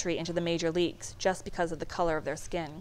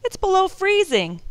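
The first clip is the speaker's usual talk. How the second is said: anxious; 11 semitones higher, 7 dB louder than usual. A narrator's reading. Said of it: "he reminded us".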